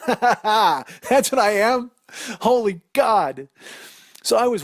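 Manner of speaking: high, loud words squeezed out through laughter, very slow; real laugh breaking through the words. Real, not acted. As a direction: crack up at yourself mid-sentence. Laughing at own bad analogy.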